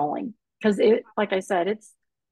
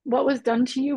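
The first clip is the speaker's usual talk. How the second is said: choked up, pitch lifted, pleading; real emotional voice; 7 semitones higher than usual. Real, not acted. Survivor to anybody listening, emotional reassurance. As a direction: speak through welling emotion, in short phrases. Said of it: "I'm gonna get a little emotional now".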